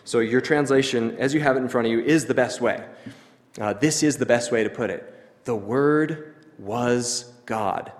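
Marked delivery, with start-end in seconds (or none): 5.4-7.9 very slow, each of Word, was, God set apart; underlined GOD. Shown as a demonstration. Preacher gives the best translation, slow and emphatic on God. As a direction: isolate God after a pause, word by word. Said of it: "But underline that. Highlight that".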